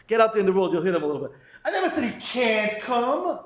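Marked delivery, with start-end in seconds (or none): 1.6-3.4 lightly voiced defensive denial; protesting doubter. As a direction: imitate someone insisting they were misunderstood. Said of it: "You ever heard these people?".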